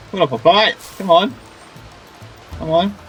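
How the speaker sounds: ordinary talk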